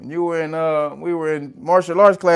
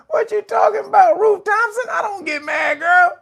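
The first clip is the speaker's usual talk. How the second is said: shrill high yell of fake innocence, much higher and louder; mock outraged falsetto shout; 6 dB louder than usual. Streamer answers Ruth Thompson's teasing with a high mock shout. Mocking denial, second round.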